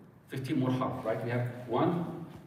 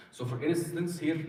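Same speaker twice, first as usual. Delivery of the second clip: ordinary talk, nothing marked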